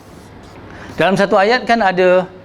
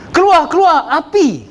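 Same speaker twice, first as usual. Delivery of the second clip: urgent shout; 10 semitones higher than usual. Shown as a demonstration. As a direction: shout the warning like the house is on fire. Warning people in burning house.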